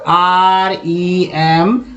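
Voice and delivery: each letter drawn out, very slowly; very slow letter-by-letter spelling. Shown as a demonstration. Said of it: "एक word ले लेते हैं remember का".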